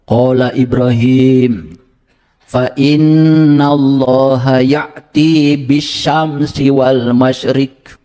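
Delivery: slow, measured chanting of the verse, each word drawn out; Quranic recitation. Shown as a demonstration. Preacher shows the congregation how the verse is recited, slow chant. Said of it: "Cara bacanya".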